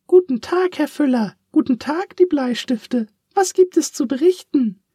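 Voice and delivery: high piping greeting to the pens; cheery little pencil voice. Acted. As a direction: chirp it out like a tiny pencil. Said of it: "fragte Pieps".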